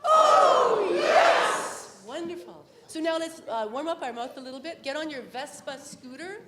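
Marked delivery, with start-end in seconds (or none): 0.0-2.0 high, loud joyful shout; cheering oh yes; excited oh yes. Shown as a demonstration. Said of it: "One more".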